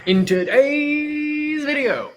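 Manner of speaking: high, slow hammed-up YouTuber intro. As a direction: stretch it like a video intro. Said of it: "That's impressive".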